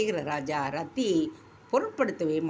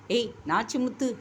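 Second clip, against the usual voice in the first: a raised call, only a mild yell; 6 semitones higher than usual. A narrator's reading. Master summoning the servant in alarm, mild shout. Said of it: "என்று அலறினான்".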